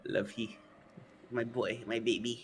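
put-on baby talk. Cooing at the creature.

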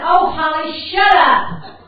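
loud, high snapped "shut up"; exasperated shout. Retold or imitated. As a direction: yell at the crying kid out of sheer exasperation. Grandmother snaps at crying granddaughter.

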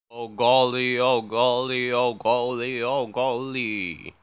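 loud fading oh golly; loud exclamation. Retold or imitated. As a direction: shout then ease off.

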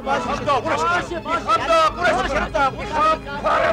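a husband raging to smash the washing machine. Acted. Man rages at washing machine.

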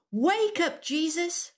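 high, urgent call-out; shouting. Shown as a demonstration. Storyteller leading children as the friends calling Jesus, shouting. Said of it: "Can we all shout this?".

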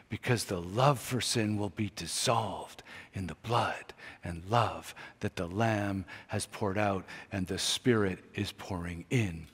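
ordinary delivery

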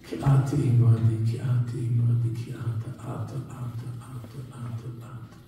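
repeated words fading to barely audible; hushed prayer. Real, not acted. Speaker telling God "You are with me", fading prayer. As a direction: start soft, dwindle away to a whisper.

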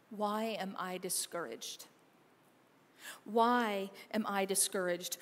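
slow, soft and low reading of a psalm; soft scripture reading. Acted. Pastor reading Psalm 43:5 to his congregation, soft and slow. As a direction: read it gently, letting each line sink in.

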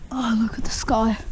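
breathy awed whisper. Pretend. Vlogger looks up and marvels in a whisper. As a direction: hush as if spotting something.

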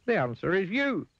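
very high, triumphant reveal; Mister Dizzy. A narrator's reading. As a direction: announce the answer in a piping voice. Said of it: "said Mister Dizzy".

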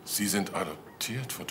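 ordinary talk

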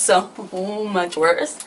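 ordinary talk, nothing marked

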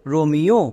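রোমিও said slowly and clearly as ro-mi-o, with the last syllable rising; teacher blends রোমিও into one word, ending on a rise; রোমিও sounded out crisply for students to copy; model pronunciation. Shown as a demonstration. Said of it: "এবার একসঙ্গে উচ্চারণ করো".

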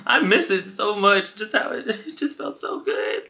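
very high, airy swoon; hammed-up rapture. Storyteller reliving a night with the date, mock rapture. Swooning over retold intimacy.

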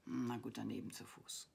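low, resigned growl; acted low grumble; grumbling dragon. A narrator's reading. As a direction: grumble in reluctant acceptance. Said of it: "knurrte der Drache".

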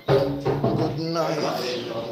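normal talking voice

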